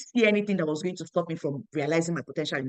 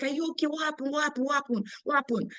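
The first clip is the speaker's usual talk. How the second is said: anxious concern; 8 semitones higher than usual. Retold or imitated. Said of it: "I was like".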